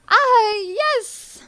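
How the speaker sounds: high, loud, drawn-out "ah, yes"; theatrical delight. Real, not acted. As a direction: greet it with a grand, high flourish. Reveals next artwork to chat.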